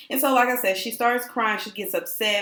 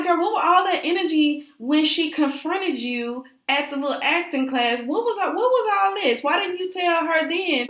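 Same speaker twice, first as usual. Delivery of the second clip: higher-pitched mock confrontation; retold challenge; 7 semitones higher than usual. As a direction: call her out in a raised pitch. Retold confronting question to Phoebe.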